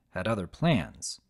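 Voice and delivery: ordinary delivery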